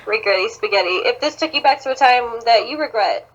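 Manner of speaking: ordinary talk, nothing marked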